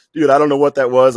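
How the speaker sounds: loud, high, baffled protest; retold bewilderment. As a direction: blurt it out in alarm, reenacting shock to a friend.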